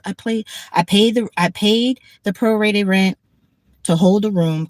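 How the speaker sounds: normal talking voice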